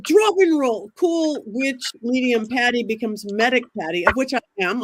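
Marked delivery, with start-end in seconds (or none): 0.0-0.9 sharp shouted order, higher and louder; urgent command. Retold or imitated. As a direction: bark it like a medic taking charge. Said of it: "I'm like".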